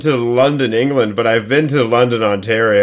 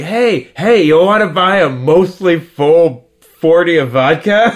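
imitating a sketchy girl; 6 semitones higher, 4 dB louder, slower than usual. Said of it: "she's like".

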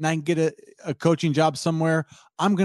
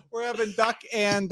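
mom voice; 7 semitones higher, 4 dB quieter than usual. Pretend. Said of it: "the Raiola mom calling him for dinner".

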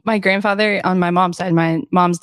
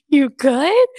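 mildly surprised retold question, pitched up; 6 semitones higher than usual. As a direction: ask it back higher, in mild disbelief. Retold reply to surprising guess.

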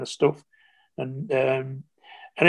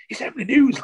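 excited wife; 10 semitones higher, 4 dB louder than usual. Retold or imitated. Wife announces famous caller.

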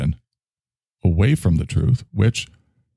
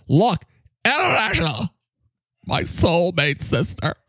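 higher, alarmed herald of doom; high, dramatic character voice; 10 semitones higher than usual. Acted. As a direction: announce the critics' invasion in a high, theatrical voice. Character voice continues.

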